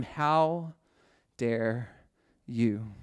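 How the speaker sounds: soft, halting how dare you, each word set apart; Jesus quietly confronting the church leaders, softly and slowly; hushed rebuke. Acted. Jesus to the leaders of that time, a hushed, halting rebuke. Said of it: "Jesus was saying to all the church leaders".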